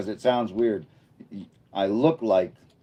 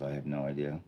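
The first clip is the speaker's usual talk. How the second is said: ordinary talk, nothing marked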